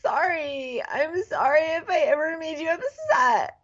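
high, whiny, fake-sobbing girl voice; mock crying like a little girl. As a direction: blubber it like a heartbroken child. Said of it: "she starts crying like a little girl".

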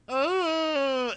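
high loud mock wail; mock sob. Chase sobbing to his mom, high mock wail. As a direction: wail an overdone fake sob. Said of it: "and he's sobbing".

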